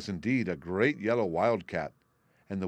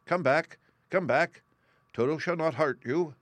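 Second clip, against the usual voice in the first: calling out; 8 semitones higher, 4 dB louder than usual. A narrator's reading. Woodman calls to the mice.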